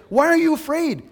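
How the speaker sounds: loud, high, commanding rebuke; imitating blunt mom. Mom tells child to rebuke fear, blunt and commanding. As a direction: order the fear out, forceful and high. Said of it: "right to the core".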